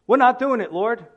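the tribes flatly refusing the Lord, defiant and loud; emphatic refusal. Retold or imitated. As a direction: dig in and refuse outright. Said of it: "It's emphatic".

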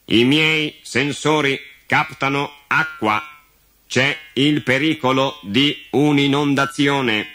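slow, high, monotone robot; computer voice. Acted. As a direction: report danger word by word like a machine. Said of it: "con la sua voce piatta da computer". (voce piatta e lenta da computer)

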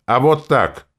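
high, loud angry retort. A narrator's reading. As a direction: throw it back with sudden temper. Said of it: "отчего-то разозлился я". (зло, резко)

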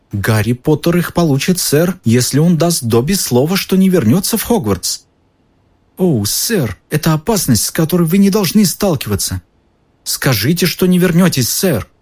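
high, begging elf voice; house elf voice. Acted. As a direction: plead like a frantic elf. Dobby begs Harry.

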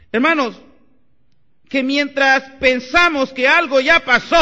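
high, loud preaching at full force; fiery preaching. Acted. Pastor preaching hard to his brothers, loud and high. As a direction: drive the point home to the congregation.

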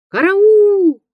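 high, loud drawn-out cry; cry for help. Acted. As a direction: call out for help through the storm. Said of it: "крик".

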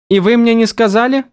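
indignant outburst. A narrator's reading. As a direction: barely hold back hysteria. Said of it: "возмутилась Вивена". (возмущённый, на грани истерики)

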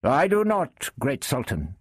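firm English knight voice. A narrator's reading. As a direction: answer firmly as a knight. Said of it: "replied the knight firmly".

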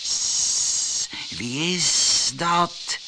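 slow sibilant snake voice; long, drawn-out serpent hiss. A narrator's reading. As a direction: hiss it, stretching every s. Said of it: "sisten de slangen".

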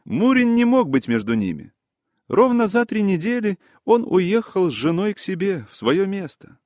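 high, busy character voice explaining; excitable gentleman reporting what he found out; animated gossip. Acted. Voices Yaroslav Ilyich explaining.

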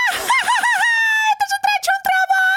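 very high, drawn-out laughing squeal; squealing through laughter. A narrator's reading. Little man recognises the brawler herb, laughing shriek. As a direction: burst out giggling in a tiny piercing voice. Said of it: "сквозь смех запищал он".

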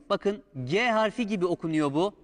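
normal talking voice

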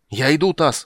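loud roared call. Acted. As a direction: bellow it out. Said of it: "взревел Карамон". (взревел)

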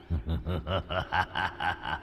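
low, soft sinister laugh; a low menacing chuckle; savage laugh. A narrator's reading. Villain laughing cruelly over the captured girl. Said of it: "cười một cách man dợ".

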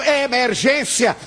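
a director's urgent cry, retold without full volume; retold shout. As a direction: call out the emergency, lightly. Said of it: "Quando ele gritou".

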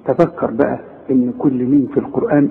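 ordinary delivery

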